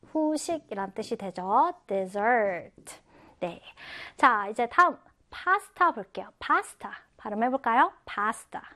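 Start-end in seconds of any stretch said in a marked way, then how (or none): 1.9-3.0 dessert with a light "de" and strong "ssert"; stress on second syllable. Shown as a demonstration. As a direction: punch the back syllable of "dessert". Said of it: "뒤에 강세가 들어가요".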